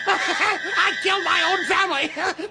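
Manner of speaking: slow, high, shouted confession; manic shout. Acted. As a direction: shout it out gleefully.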